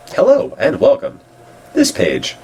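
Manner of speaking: very high-pitched hello; mock welcome. Reading a page's greeting to visitors, high mock welcome. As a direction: greet them in a put-on high voice.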